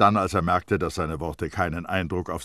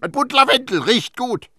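ranting at her, pitched way up; bellowing; 10 dB louder than usual. A narrator's reading. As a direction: hammer out each example in exasperation. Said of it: "brüllte Terrier sie an".

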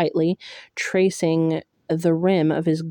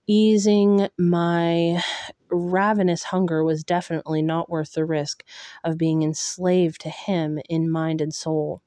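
ordinary delivery, nothing marked

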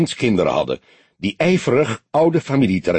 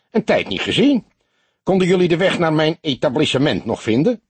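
lightly higher chirpy host voice; cooing barkeeper; 7 semitones higher than usual. A narrator's reading. Cheerful host greeting old detective friends, lightly chirpy. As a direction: chirp the welcome back, quick. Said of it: "kirde hij".

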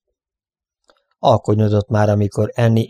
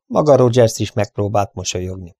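usual speaking voice

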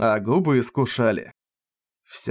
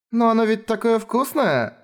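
high, cute pouty whine; pouting; 10 semitones higher than usual. Acted. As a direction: pout as you protest. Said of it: "надула щечки Ренге".

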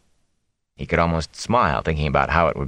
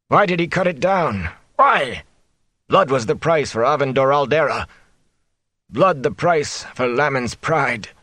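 higher, pleading delirious outburst; feverish anguished cry; 7 semitones higher than usual. A narrator's reading. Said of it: "Though he sounded angry".

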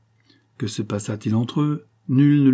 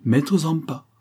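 higher, mildly exasperated address; impatient countess; 6 semitones higher, 4 dB louder, slower than usual. A narrator's reading. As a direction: call him to order with mild impatience. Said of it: "impatientèrent la comtesse". (comtesse impatiente)

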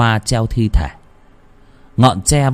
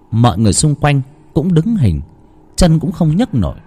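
usual speaking voice

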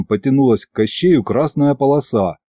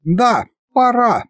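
high, slow regal voice; high goddess voice; 12 semitones higher, slower than usual. Acted. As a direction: proclaim it from above.

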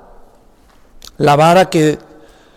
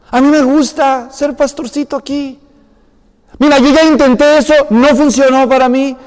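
whiny Moses; 5 semitones higher than usual. Acted. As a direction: beg God to send anyone but you. Moses begging God to send another.

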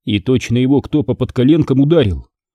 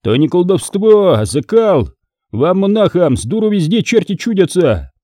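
lecturing; 7 semitones higher than usual. Acted. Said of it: "Потом наставительно".

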